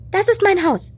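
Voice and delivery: put-on high little boy's voice; a child proudly showing his home; plainly voiced child; small boy explaining. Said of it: "erklärte Noah".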